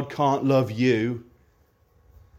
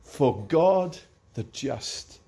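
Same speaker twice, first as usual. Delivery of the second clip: hymn recitation. Acted. Recites hymn verses slowly.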